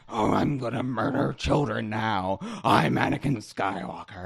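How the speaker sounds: lightly voiced villain, mock menace; a hint of Richard Nixon; Nixon-style Anakin voice. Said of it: "my go-to I'm going to murder voice".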